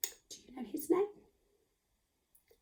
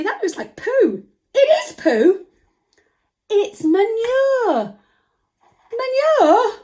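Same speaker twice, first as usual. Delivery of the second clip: high, loud hammed-up disgust; mock horror at manure for children; 11 semitones higher, 7 dB louder, slower than usual. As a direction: squeal at the smelly poo.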